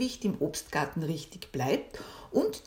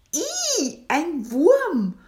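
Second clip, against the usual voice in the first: high, loud "ih" of disgust; disgusted shriek; 11 semitones higher, 8 dB louder, much slower than usual. Acted. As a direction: squeal at the sight. Bee discovers worm in apple. (angeekelter Aufschrei)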